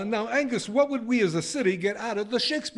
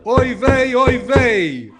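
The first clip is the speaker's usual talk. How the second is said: put-on Yiddish accent; 5 semitones higher, 7 dB louder, much slower than usual. Council head's exclamation.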